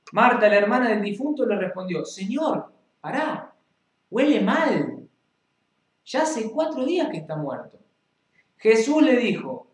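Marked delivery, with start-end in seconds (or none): 2.0-7.8 higher, pleading objection from the dead man's sister; grieving sister warning that the body smells. Acted. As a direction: protest anxiously, voice lifted high. Marta objects to Jesus.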